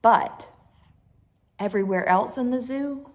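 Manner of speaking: ordinary delivery, nothing marked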